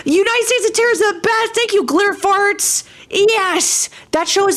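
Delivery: high-pitched, delighted fan exclamation; excited gushing. Real, not acted. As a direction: gush in a held high pitch. Gushing over a favorite show.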